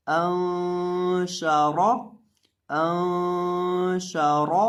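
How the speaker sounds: अंशारा chanted very slowly, the noon hummed nasally and the रा full; ghunna on noon. Shown as a demonstration. Teacher showing students how the word should sound, slow nasal ghunna. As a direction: stretch अंशारा with a long nasal ghunna in a sung tone. Repeat recitation.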